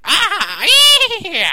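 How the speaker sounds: high, loud whooping game voice; character yell. Retold or imitated. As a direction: belt out a wild, laughing yell. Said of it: "there's a guy who goes like".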